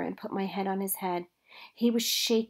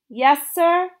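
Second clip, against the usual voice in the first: "Yes, sir?" repeated louder and higher; 9 semitones higher, 10 dB louder than usual. A narrator's reading. Girl answers the preacher again, louder and higher. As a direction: raise the voice so he hears. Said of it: "I said louder".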